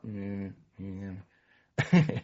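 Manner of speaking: low, quiet reluctant mumble; sulky grunt. Retold or imitated. Bill answering Lois, sulky and quiet. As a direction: answer grudgingly, hardly bothering.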